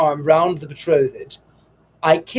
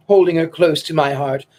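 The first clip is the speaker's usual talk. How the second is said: ordinary delivery, nothing marked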